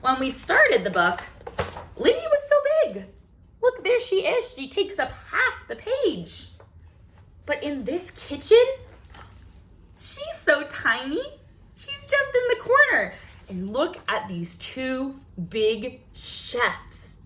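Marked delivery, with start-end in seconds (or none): 2.0-13.1 amazed. Real, not acted. Marvels at size in picture.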